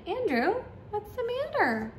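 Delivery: put-on high-pitched kid voice; storybook pal, pitched high; concerned young friend. A narrator's reading. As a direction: ask in a high, lilting child voice. Best friend greets sad boy.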